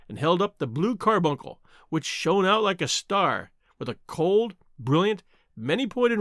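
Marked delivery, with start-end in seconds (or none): none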